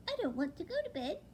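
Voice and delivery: high, fast owlet protest; a little owl refusing bed; fast whiny owlet. Acted. Owlet protesting to his mother, high and fast. Plop's voice in the story.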